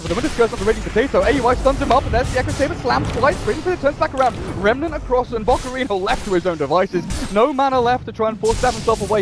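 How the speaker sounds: fast, high, rising commentary; excited play-by-play. Real, not acted. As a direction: call the fight at full speed. Live play-by-play.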